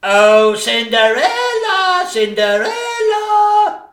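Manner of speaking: a taunting sing-song call. Acted. Calling out for Cinderella.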